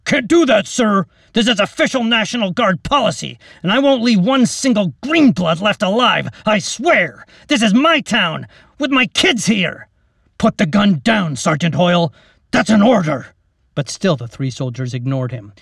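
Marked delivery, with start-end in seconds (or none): 0.0-9.9 high, loud heated refusal; defiant refusal. Acted. Soldier refusing captain's order. 10.4-13.3 loud, firm order; command. Acted. Captain commanding his sergeant.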